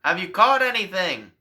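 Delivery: a loud, high call across the water. Acted. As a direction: shout out to the boat from the shore. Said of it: "calls out to them".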